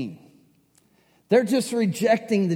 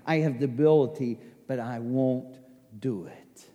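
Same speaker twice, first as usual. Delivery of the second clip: tender Jesus; 3 semitones lower than usual. Retold or imitated. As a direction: speak it low and tender, as forgiveness. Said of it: "He says".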